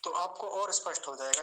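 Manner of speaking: normal talking voice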